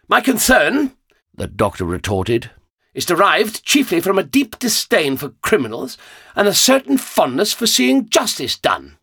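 a touch of a lofty, indignant gentleman; haughty gentleman. A narrator's reading. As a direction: speak down to them with disdain. The Doctor's lofty voice.

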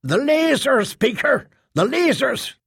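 high-pitched desperate exclamation; tinge of hysterical desperation. A narrator's reading. Trader appeals to the speaker about lasers, with hysterical desperation. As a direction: cry out urgently, pitched high. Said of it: "a tinge of hysterical desperation".

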